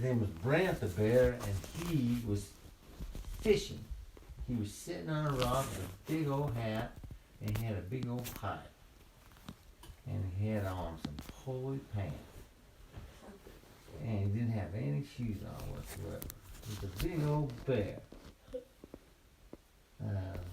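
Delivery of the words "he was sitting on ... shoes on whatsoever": ordinary delivery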